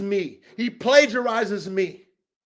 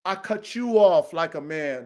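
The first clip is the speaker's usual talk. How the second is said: ordinary delivery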